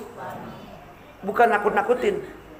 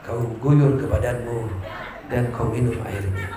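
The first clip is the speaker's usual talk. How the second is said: tender husband; 10 semitones lower, 6 dB quieter than usual. Acted. Preacher voices a husband praising his wife Rika, low soft mock romance. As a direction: coo it like a smitten husband. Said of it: "Pasti ngomongnya begini lagi".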